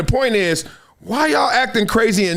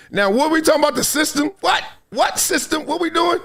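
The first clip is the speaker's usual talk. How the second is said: high-pitched baffled questioning; real bewilderment, pitched up; incredulous; 8 semitones higher than usual. Real, not acted. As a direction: question it in disbelief, voice raised high. Incredulous reaction to the clip.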